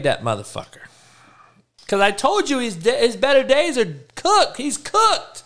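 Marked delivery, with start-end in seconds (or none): 1.9-5.4 high, strained outburst; exasperated rant. Real, not acted. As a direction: push it up high, fed up. Keeps ranting about the player.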